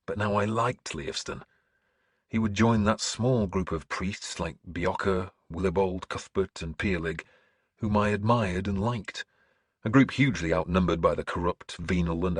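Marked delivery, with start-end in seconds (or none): none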